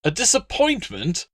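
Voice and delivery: high outraged splutter; flustered dog dealer spluttering. Acted. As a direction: choke out the word in disbelief. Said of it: "Appius spluttered".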